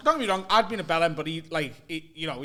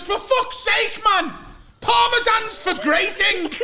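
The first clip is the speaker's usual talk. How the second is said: high, exasperated mock shout; flustered outburst; 14 semitones higher, 7 dB louder, much slower than usual. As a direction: explode like a flatmate at breaking point. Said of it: "he gets, like, in a fluster".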